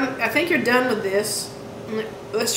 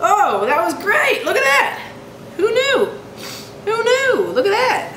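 high, swooping excited squeals; delighted amazement; 10 semitones higher than usual. Real, not acted. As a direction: marvel at it in a high voice. Delighted at how the fillet came out.

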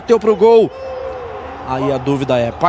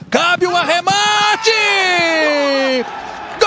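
excited call; 5 semitones higher, 4 dB quieter than usual. Real, not acted. Narrator calls the finish.

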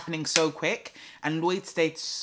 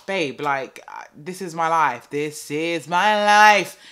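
building to a loud, slow cry of "This is my life"; plead, then let it burst out; exasperated plea; 8 dB louder, slower than usual. Retold or imitated. Frustrated plea to midwife.

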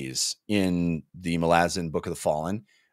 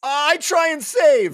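high, loud desperate cry; desperate plea; 6 dB louder, slower than usual. Real, not acted. Player pleading with the DM for a save, high and loud. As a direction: beg the DM for a save.

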